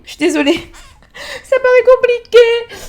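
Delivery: high, loud, squealing through laughter; laughing apology. Real, not acted. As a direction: burst out laughing while speaking. Laughing over the vanished T. (en riant aux éclats, voix aiguë)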